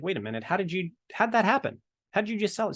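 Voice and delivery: high-pitched mock amazement; astonished onlookers. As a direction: imitate baffled colleagues, pitch climbing sharply.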